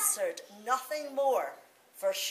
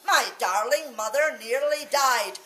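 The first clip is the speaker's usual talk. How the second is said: ordinary delivery, nothing marked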